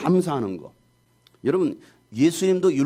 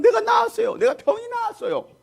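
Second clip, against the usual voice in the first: acted amazed joy. Healed man telling the whole neighbourhood, amazed and overjoyed high cry. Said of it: "너무나 놀랍고 기뻐가지고".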